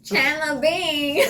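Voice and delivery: Chandler Bing impression. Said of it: "my accent is Fran from Friends".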